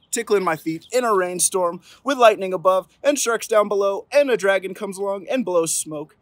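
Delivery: storybook climax; building excitement. A narrator's reading. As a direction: pile it on with gusto. Escalating cumulative refusal.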